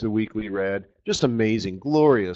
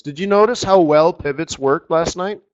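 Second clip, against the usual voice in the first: ordinary delivery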